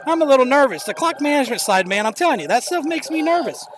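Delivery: real nerves, confessed to the listeners; nervous. Real, not acted. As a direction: let the nerves push your pitch up. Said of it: "hear the nerves in my voice".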